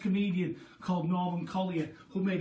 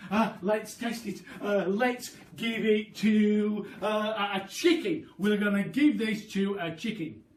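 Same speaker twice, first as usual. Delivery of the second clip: high, loud, eager rush; tumbling out the plan, overexcited; excited father; 3 semitones higher, 6 dB louder than usual. Acted. Mister Cranky eager to test the medicine, high and loud.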